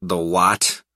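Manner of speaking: blank question. A narrator's reading. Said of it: "somewhat blankly".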